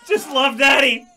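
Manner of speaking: loud, mock-desperate dad pleading; screaming at baby. Pretend dad begging baby Timmy to love Daddy, hammed-up screaming. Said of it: "Stop screaming at the baby".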